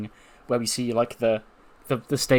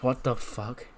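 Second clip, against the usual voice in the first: muttered disbelief; 7 dB quieter, slower than usual. Acted. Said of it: "she's like".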